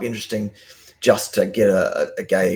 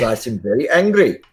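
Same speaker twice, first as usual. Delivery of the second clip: mock UK accent; 4 semitones higher, 4 dB louder than usual. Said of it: "I know that's not your accent".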